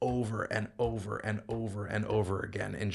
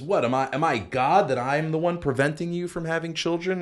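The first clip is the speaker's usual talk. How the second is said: indignant retort, higher pitched; angry Jacob; 7 semitones higher, 4 dB louder than usual. Acted. Jacob rebuking Rachel over her plea for children, angry. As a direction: throw it back as an exasperated rhetorical question. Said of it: "Jacob is angry with Rachel".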